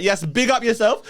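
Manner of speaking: high, slow, drawn-out hype greeting; hyped-up guest. Retold or imitated. Host teases guest about his arrival, hyped-up impression. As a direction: do a mock impression of the guest bursting in. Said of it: "Is that how I sound?".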